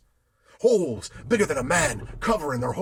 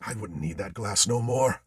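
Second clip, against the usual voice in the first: plain reading voice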